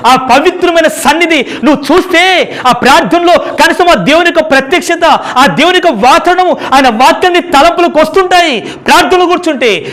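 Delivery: high, loud impassioned preaching; preaching at full force. Acted. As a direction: press the point hard on the brothers and sisters. (ఉద్వేగంతో బోధించడం)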